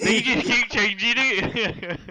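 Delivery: loud, high, slow through laughter; laughing protest. Real, not acted. Game master teased over changing accents, real laughing protest. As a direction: protest helplessly while cracking up.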